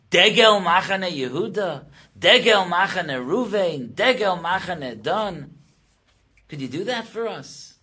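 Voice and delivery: loud, high rhetorical demand; fervent, ringing questioning; impassioned challenge. Acted. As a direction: throw the challenge back with full preaching force. Israel challenges the nations' offer.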